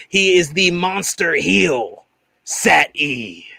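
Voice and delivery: slow, drawn-out ring-announcer intro; announcer introduction. Acted. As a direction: stretch the guest's name like an announcer.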